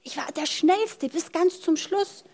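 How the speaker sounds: high, slow silly dog voice; silly dumb pet voice. Acted. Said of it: "Dann sagt der Hund".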